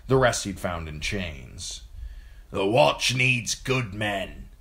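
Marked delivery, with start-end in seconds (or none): 2.5-4.5 put-on slow, higher voice of a hard old recruiter; Yoren addressing the recruits. A narrator's reading. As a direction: lay it out slowly, like a man with no patience. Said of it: "he told them".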